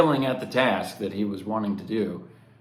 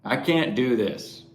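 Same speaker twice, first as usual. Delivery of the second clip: resigned despair; 3 semitones higher, slower than usual. Retold or imitated. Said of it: "In our moment of resignation".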